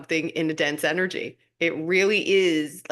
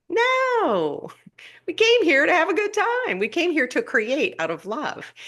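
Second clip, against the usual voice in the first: emphatic no; 10 semitones higher than usual. Real, not acted. Rejecting the struggle mindset.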